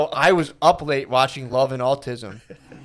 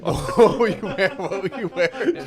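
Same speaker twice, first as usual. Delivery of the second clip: laughing, higher; 5 semitones higher than usual. Real, not acted. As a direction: ask it through laughter. Laughing at friend's fall story.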